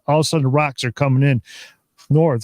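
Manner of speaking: ordinary delivery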